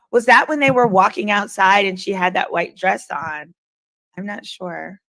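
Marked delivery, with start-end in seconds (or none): none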